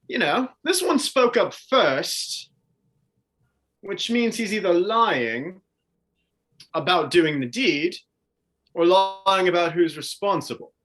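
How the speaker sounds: lightly voiced inquisitor, musing with swoops in pitch; Tristan, theatrical interrogator. Tristan questioning two lying captives, theatrical and deliberate. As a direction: muse aloud, then press for an answer.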